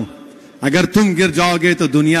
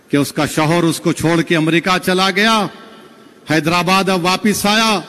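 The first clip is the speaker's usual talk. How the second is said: ordinary talk, nothing marked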